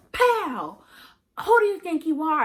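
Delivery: father's voice, high and loud, barking the question; angry father yelling. Retold or imitated. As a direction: shout it like a furious dad mid-beating. Father yelling during a whooping.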